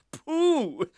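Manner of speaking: loud, high burst of scorn; scoffing cockerel. A narrator's reading. Cockerel rejects the offer of help, scoffing. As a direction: dismiss it with a scoff. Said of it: "scoffed Coco the Cockerel".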